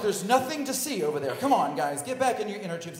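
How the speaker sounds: loud, high counselor orders; acted-out flustered calling out; urgent herding. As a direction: hurry the kids away from the sight. Counselor herding excited boys away.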